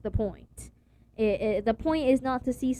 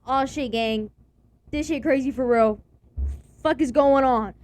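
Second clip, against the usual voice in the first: put-on shocked kid; 3 semitones higher, 7 dB louder than usual. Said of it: "I was like".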